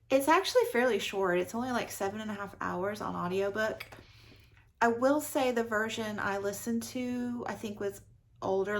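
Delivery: ordinary talk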